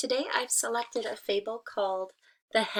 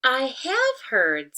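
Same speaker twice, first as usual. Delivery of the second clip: slow, louder knowing hen; sly hen; 5 semitones higher, 8 dB louder, much slower than usual. Acted. Hen tricking the wolf, slow and sly. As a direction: set the trap slowly. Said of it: "said the hen".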